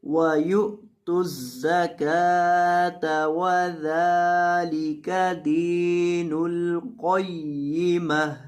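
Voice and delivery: slow, drawn-out tajweed chanting; Quran recitation. Shown as a demonstration. Teacher recites the verse for students, slow chanted recitation. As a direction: recite each word slowly, stretching the vowels.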